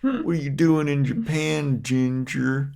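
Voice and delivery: jeering high taunt; mocking bully. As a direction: sneer at the redhead. Villagers taunting the redhead.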